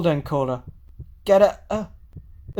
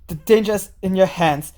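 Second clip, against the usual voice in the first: ordinary talk, nothing marked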